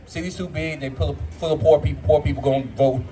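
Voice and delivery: ordinary delivery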